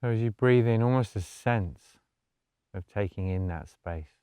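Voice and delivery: soft, low-key meditation voice with long pauses; guided meditation. Acted. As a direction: gently guide them into stillness, hushed and unhurried.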